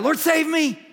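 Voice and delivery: high, loud cry for rescue; desperate cry. Retold or imitated. Sinking Peter crying out to the Lord, desperate shout. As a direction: yell it as you sink. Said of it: "he cried out".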